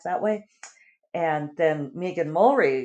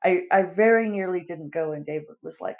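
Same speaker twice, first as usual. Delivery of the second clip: ordinary talk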